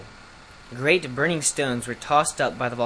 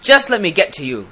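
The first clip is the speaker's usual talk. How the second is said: loud, angry beast's threat; hoarse, angry; 4 semitones higher, 8 dB louder than usual. A narrator's reading. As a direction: threaten them, pitched up and loud. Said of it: "in a hoarse, angry voice".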